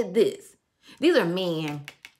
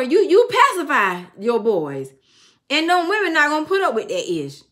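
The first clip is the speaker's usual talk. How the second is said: telling mom straight, raised voice; scolding mom; 8 semitones higher, 7 dB louder than usual. Retold or imitated. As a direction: lay it down firmly to mama, high and loud. Telling mom off about brothers.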